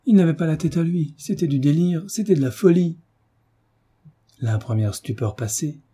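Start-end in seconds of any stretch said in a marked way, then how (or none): 0.1-3.0 higher-pitched, urgent insistence; agitated, imploring defence; frantic pleading. A narrator's reading. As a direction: plead the excuse desperately, phrase upon phrase. Mother frantically excuses her son.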